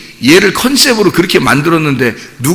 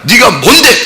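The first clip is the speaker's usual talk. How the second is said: high-pitched, acted challenge; haughty acted put-down; confrontational challenge; 7 semitones higher, 3 dB louder, slower than usual. Repeats the acted challenge before knockout.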